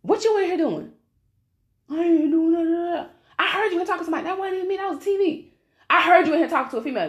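high, put-on bickering voices; hammed-up domestic interrogation; mock parent-son argument. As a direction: act out a scolding exchange, pitched high. Acts out mom confronting son.

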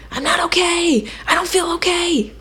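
high, breathy panicked whisper; panicky. Shown as a demonstration. Panicky inner voice on a rough day, high and breathless. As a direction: sound like you're losing it. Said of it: "maybe it's just kinda panicky".

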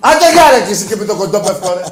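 high, loud outburst at the caller; angry shout. Real, not acted. Host dismissing a caller from Kontopefko, angry shout. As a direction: yell the caller off the line.

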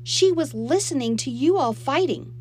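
high, loud tattling; heated protest. A narrator's reading. Girl telling Mom on her stepsister, heatedly. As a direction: protest loudly and indignantly.

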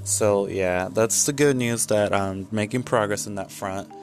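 ordinary delivery

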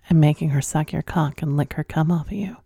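unvoiced, breathy-free naughty whisper; soft, unvoiced, sultry tease; teasing whisper. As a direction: lean in and whisper it like a secret. Teasing listeners about their sex life.

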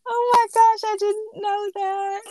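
high, delighted laughing. Real, not acted. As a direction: laugh through the words. Laughing at sweet story.

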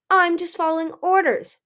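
high, loud defensive protest. A narrator's reading. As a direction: push back in self-defence. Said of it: "Peril protested".

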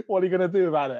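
laughing mock bravado at the police; cheeky defiance. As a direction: dare them, smart-mouthed. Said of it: "I'm getting a bit like".

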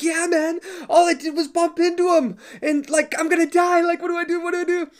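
terrified friend. Retold or imitated. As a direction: imitate a friend convinced he's about to die. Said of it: "he's all shaking".

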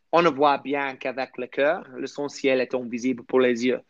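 careful French accent; best French accent. Shown as a demonstration. Reciting a quote from The Little Prince in best French accent. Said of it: "that's my best accent".

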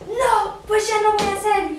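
más alto y fuerte, cortante; negativa gritada imitada. Retold or imitated. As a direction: remeda a la empleada negándose a gritos. La señorita se niega tajante. (empleada que se niega gritando, imitada)

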